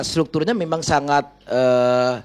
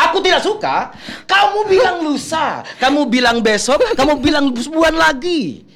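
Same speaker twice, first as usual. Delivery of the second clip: high, loud debt-collector scolding; acted anger; 12 semitones higher, 3 dB louder than usual. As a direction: scold a debtor who keeps stalling. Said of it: "acting marah sama saya". (marah menagih utang dengan suara tinggi)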